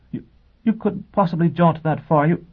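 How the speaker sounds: high, halting alarm; frightened stammer. Acted. Teacher terrified by what Foyle can do, stammering.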